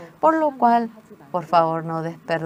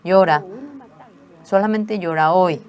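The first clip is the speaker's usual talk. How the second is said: much louder, firm permission to cry; firm command; 2 semitones higher, 7 dB louder than usual. Retold or imitated. Mother orders daughter to cry only today, firm loud. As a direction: say it loud and final. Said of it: "me dijo".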